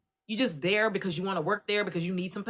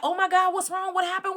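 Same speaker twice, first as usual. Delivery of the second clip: high panicked questions; startled aide asking what went wrong; alarmed; 11 semitones higher than usual. Retold or imitated. New aide panicking after the man screams, high alarmed. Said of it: "And I was like".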